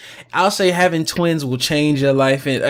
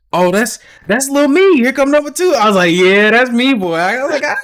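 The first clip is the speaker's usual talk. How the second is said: much higher, louder joyful cheer; excited new dad; 9 semitones higher, 4 dB louder than usual. Retold or imitated. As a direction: celebrate each baby arriving.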